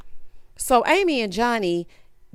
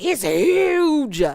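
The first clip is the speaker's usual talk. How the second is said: higher, stretched-out exclamation; imitating an impressed woman, pitched up; mock gushing; 7 semitones higher, 3 dB louder than usual. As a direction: gush it with hammed-up awe. Mimicking Chelsea's boast about size.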